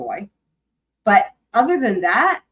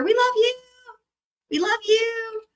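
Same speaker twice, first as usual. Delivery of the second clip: cheer; 13 semitones higher than usual. Real, not acted. Cheering on a chat friend.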